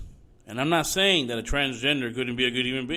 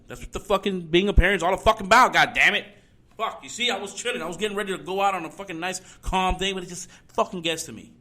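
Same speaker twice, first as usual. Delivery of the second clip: higher, louder, boiling over; angry outburst; 5 semitones higher, 5 dB louder than usual. Real, not acted. Host erupting at parents to protect their kids, furious. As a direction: let it burst out in real frustration. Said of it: "not yell at everybody so much".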